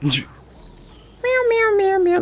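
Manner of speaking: high playful meowing like a cat. Pretend. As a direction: meow like a little kitten. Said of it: "I made my own cat noise".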